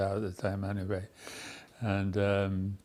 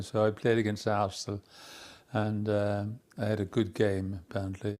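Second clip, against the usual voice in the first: ordinary talk, nothing marked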